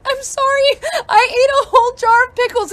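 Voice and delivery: very high, squealed through mock hysterics; hysterical laughing apology. Wife apologizing to husband from the bathroom, hysterical squeal. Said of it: "I'm hysterically laughing".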